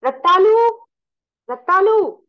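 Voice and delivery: loud, high call across the yard. A narrator's reading. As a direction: call her name out loudly. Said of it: "Called out".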